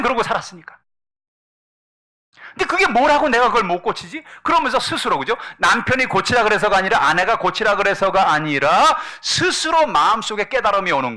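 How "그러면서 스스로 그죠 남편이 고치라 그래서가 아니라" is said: usual speaking voice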